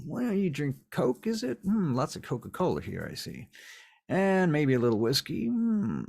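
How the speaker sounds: high, lilting mock-hospitable voice; sly, sing-song musing pitched high; taunting villain. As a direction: toy with them while playing host. Garwood playfully helps himself to drink.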